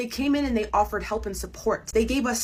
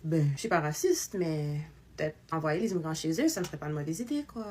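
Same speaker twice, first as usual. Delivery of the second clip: mock French accent; 3 semitones lower, 5 dB quieter than usual. Impersonating a French person asked about racism, in a French accent. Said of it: "my impression of a French person".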